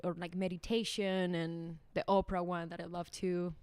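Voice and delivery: ordinary talk, nothing marked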